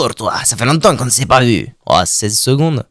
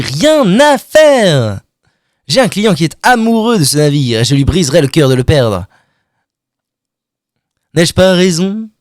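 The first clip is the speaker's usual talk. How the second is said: salesman voice; 3 semitones higher than usual. Acted. Stan the salesman, voiced by streamer.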